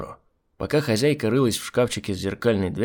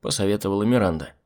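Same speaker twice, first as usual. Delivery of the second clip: ordinary delivery, nothing marked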